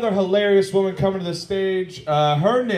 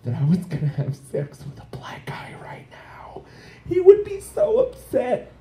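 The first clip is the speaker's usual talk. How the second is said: mock prim hushed confession; playing a nervous porn actress confessing; quoting the actress; 5 semitones lower than usual. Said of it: "And she's like".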